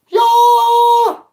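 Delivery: a huge high shout; ring entrance yell. Pretend. Picturing a cartwheel into the ring, a wild high shout. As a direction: belt it out like a showman entering the ring.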